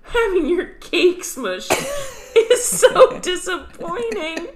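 put-on high, slow sad kid voice; Daniel Tiger voice. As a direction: whine it slowly like a cartoon kid. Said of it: "he says".

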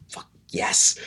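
forceful, voiceless whisper; whispered triumph. Retold or imitated. Traveler finding the right roommate, whispered triumph. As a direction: celebrate under your breath.

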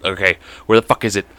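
normal talking voice

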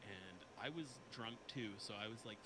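very soft, distant-sounding storytelling; too far from mic. Real, not acted. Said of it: "talk a little bit closer to your mic".

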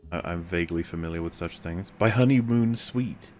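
ordinary talk

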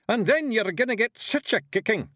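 loud, high Scottish yell; Scottish shout. A narrator's reading. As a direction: bellow it like a tiny angry Highlander. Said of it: "yelled Rob Anybody".

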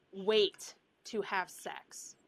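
very slow, deliberate advice; slow each word for weight; slow and emphatic. Real, not acted.

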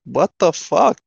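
high-pitched incredulous protest; retold exasperation. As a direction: protest in disbelief, pitch rising. Retold exasperated reply to screaming.

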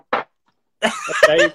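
teasing falsetto squeak; mock squeal. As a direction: squeak the name out in jest. Repeats name in mocking squeal.

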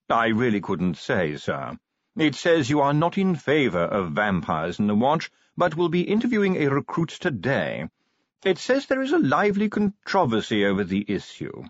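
formal butler. Acted. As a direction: read the news out like a proper manservant. Voices butler in story.

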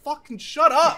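loud, high exasperated shout. Retold or imitated. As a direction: snap it out loud. Retorting to the imitated YouTuber.

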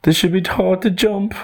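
high, emphatic kangaroo voice; emphatic cry. Acted. Kangaroo pitches his lesson to the others, emphatic high cry. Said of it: "cried the kangaroo with emphasis".

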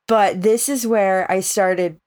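ordinary talk, nothing marked

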